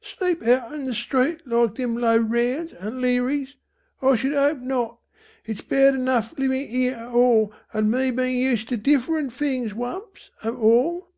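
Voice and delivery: high-pitched indignant Cockney mother; reply sharply in a high voice. A narrator's reading. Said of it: "she said sharply".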